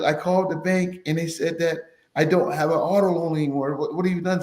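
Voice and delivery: lightly voiced mom, pitch up and pleading; mother's anxious voice. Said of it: "She goes".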